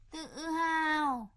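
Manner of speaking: tự hào drawn out slowly, mocking; sneering. Stepmother mocking the father's pride, repeating "tự hào" slowly and scornfully.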